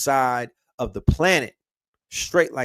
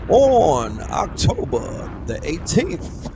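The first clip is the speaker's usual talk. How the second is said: slow, drawn-out hype announcer voice, louder; event announcement; 3 semitones higher, 3 dB louder, slower than usual. Acted. Streamer announces the Ital Gardens event to viewers, slow hype read. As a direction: stretch out every word like a host.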